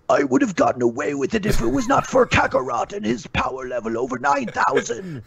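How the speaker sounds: unmasked villain griping, high and theatrical; Vegeta as Scooby-Doo villain. Pretend. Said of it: "the second Scooby-Doo reference".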